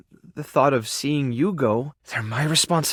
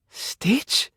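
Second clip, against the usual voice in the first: tentative, rising, pitched up; disbelieving question; 5 semitones higher than usual. Acted. Seeing long-lost Stitch, is that really you, disbelieving. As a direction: ask the name as if afraid to believe it.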